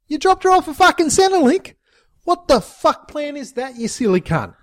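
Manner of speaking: high, incredulous scorn; mock his stupid plan; mocking disbelief.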